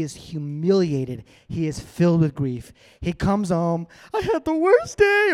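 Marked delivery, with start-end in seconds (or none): 4.1-5.3 very high-pitched hammed-up whine; mock wailing. Humiliated Haman comes home to his wife, mock wailing. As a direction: pour out the misery in a mock whine. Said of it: "He's ready to pour out".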